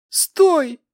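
high desperate sobbing cry; sobbing plea. A narrator's reading. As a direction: beg through tears. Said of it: "прокряхтел рыдающий мальчишка".

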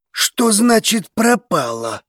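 incredulous, slow question; demanding question. A narrator's reading. Alarmed question on overheard news.

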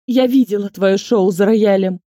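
loud accusing snarl; angry accusation. A narrator's reading. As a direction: accuse her outright. Inga accuses heroine.

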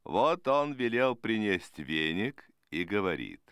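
ordinary delivery

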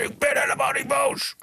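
high-pitched, strained yell of denial; mock angry shout. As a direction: imitate someone yelling in furious denial. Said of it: "echt daar tegen zit te schreeuwen".

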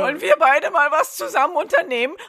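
high, loud, eager pleading voice; pushy clingy boy. Acted. Neville begging Ron for a date, high eager pleading.

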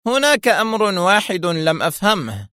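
high-pitched voicing of a puzzled villain; villain questioning captive. A narrator's reading. Shimon turns back to Adham, puzzled challenge.

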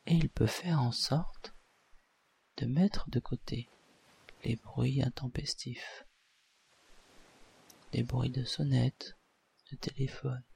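soft, calm hypnotist induction; hypnosis voice. Acted. Leads a hypnosis session.

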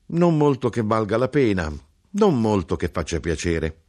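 scornful street crier, fading down; a hint of a mocking hawker. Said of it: "di intonazione beffarda".

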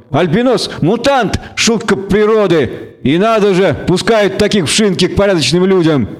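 high-pitched, scornful exclamations; sneering tavern bully. A narrator's reading. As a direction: voice a contemptuous half-elf heckler.

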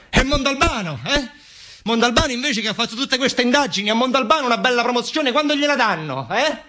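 voce molto più alta e caricata, da macchietta; voce da caricatura. Acted. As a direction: fai il verso a un poliziotto sbruffone. The mocking character voice returns. (macchietta acuta e beffarda)

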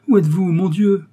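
high, loud cry of searching; anxious call. A narrator's reading. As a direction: call out urgently for someone you cannot find. Young man searching for his beloved. (appel angoissé, fort et aigu)

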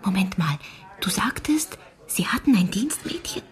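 hushed whispered question; whispering. Acted. Girl whispers, surprised there was a maid, hushed whisper. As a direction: whisper it, suddenly curious. Secret talk about a murder.

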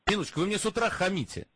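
ordinary talk

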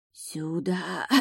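soft eerie whispered call; таинственный шелест. A narrator's reading. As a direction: breathe it like a ghostly lure. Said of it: "Таинственно протяжный шелест". (протяжный шелест-зов)

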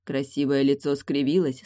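plain reading voice

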